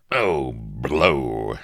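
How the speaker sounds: loud exclamation of exasperation; exclaimed. A narrator's reading. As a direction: burst out with a loud oath. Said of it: "exclaimed Avery".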